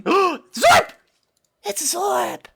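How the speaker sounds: high, loud gasp of delight; squealing high with real delight; excited squeal. Real, not acted. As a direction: shriek with joy at the discovery. Gasps spotting a favourite creature.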